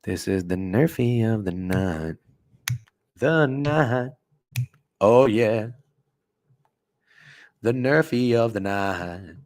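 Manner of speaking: slow drawn-out nerfie call; hype catchphrase. Acted. Streamer naming the nerfie of the night, slow hype. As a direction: stretch it like a hype announcement.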